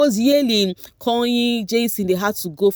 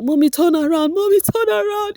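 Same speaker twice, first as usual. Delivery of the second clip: high, excited plea rising higher; excited son; 11 semitones higher, 3 dB louder than usual. Retold or imitated. Son urging his mom to spin, excited and high. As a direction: squeal it like an eager son.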